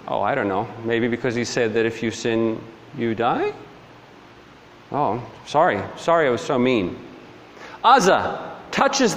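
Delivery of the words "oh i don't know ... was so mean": dry, lower, softer deadpan; understated sarcastic mock apology. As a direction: answer the outrage with mock innocence, voice dropped. Sarcastic answer to the mock objectors.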